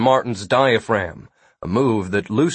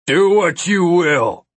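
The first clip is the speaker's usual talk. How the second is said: raised, slow defiant shout; 7 semitones higher, 4 dB louder, slower than usual. A narrator's reading. Nilo facing his three attackers, defiant shout. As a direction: call out the dare, drawn out and high. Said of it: "he shouted".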